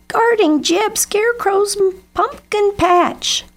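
very high, squealed alarm; shrieking crow. A narrator's reading. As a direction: stretch it out in a panicked high crow voice. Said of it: "shrieked J-, Lucy Crow".

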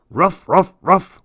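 high playful barks; dog barking. A narrator's reading. Marley the dog joining the begging, bright barks. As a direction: bark like an eager puppy. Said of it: "Marley said".

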